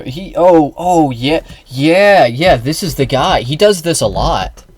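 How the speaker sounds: high, loud dawning realisation; light up as it comes back to you; sudden recognition. Real, not acted. Suddenly recognises who the person is.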